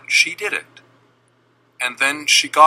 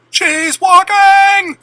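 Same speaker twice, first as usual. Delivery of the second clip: very high, loud, drawn-out slow shout; full screaming; 9 dB louder, much slower than usual. Retold or imitated. Parents watching their daughter walk, drawn-out ecstatic scream. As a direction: stretch the scream out in disbelief. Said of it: "My parents started screaming".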